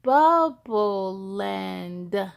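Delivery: very slow, sounding out each word; child just learning to read; reading slowly. Acted. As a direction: read it like a beginner reader. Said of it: "I read out loud slowly".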